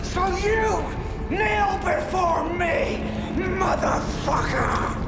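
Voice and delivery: high, slow, furious roaring shout; villain roar. Retold or imitated. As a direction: bellow it like a raging tyrant. Acting out the villain's speech.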